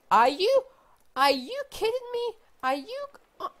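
high, loud squeal with a cry in it; real high-pitched squeal of disbelief. Real, not acted. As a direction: shriek at the unbelievable luck. Another diamond find, squealing.

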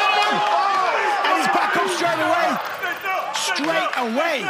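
slow, drawn-out call pitched high and loud; excited commentary. Real, not acted. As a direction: marvel that he bounced right back. Commentator reacts to fighter getting up.

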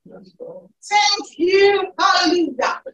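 loud, high, full-force praise shouts; fervent praise. Real, not acted. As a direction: cry out thanks to God at full power.

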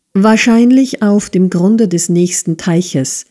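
ordinary delivery, nothing marked

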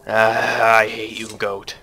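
defeated mutter to the goat; fading grumble. Pretend.

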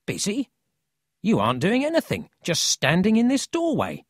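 put-on high, incredulous boy's voice; scoffing disbelief. A narrator's reading. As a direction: challenge his excuse. Paul scoffs at Emil's claim.

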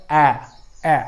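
ॲ, ॲ as isolated "a" of "cat"; short A. Shown as a demonstration. Teacher answering own question, ॲ said separately twice. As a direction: repeat ॲ alone, with a pause. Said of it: "कसा केलेला आहे".

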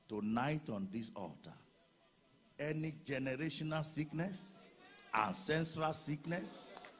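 high roaring preacher's shout, dragged out slowly; preaching at full force. Acted. Pastor proclaiming healing scripture over the congregation, roaring.

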